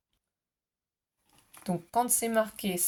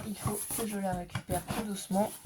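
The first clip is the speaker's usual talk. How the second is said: ordinary delivery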